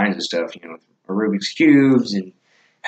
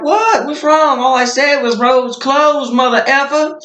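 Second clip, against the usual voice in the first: very high, put-on baffled voice; mimicked high-pitched indignant defense; imitated innocent protest; 4 dB louder than usual. Imitates Talon protesting the scolding.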